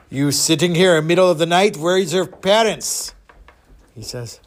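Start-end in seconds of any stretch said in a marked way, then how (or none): 0.1-3.1 put-on accented big-man questioning; heavy put-on voice, slowing at the end; huge gruff man. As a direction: interrogate the kid as a giant. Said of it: "three hundred pound, seven-foot man".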